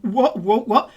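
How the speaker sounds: stuttering, lost for words; flustered stammer. Acted. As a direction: sputter in bewilderment. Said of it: "He's like".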